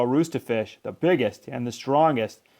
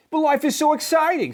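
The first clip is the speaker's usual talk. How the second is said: eager protest; 14 semitones higher than usual. A narrator's reading. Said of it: "said Clark".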